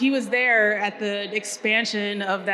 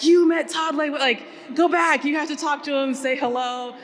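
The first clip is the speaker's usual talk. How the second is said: excited dad; 5 semitones higher than usual. Retold or imitated. Dad hearing his son met Todd Leiweke, excited disbelief. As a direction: play the thrilled father who can't believe it. Dad amazed by brother's news.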